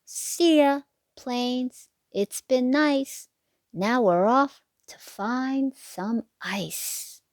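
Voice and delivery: put-on lilting sing-song read to little kids; bouncy storybook rhyme voice; reading to children. A narrator's reading. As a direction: read the rhyme like a bedtime picture book. Rhyming picture book read aloud.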